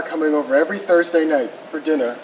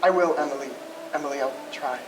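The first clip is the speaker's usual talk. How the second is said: tender promise; 4 semitones lower, 8 dB quieter, faster than usual. Acted. Groom reassures the bride.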